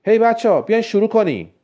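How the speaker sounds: high, loud call to the group. A narrator's reading. Jack calls the group to start the meeting, loud high call. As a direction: shout to get everyone started. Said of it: "جک فریاد کشید".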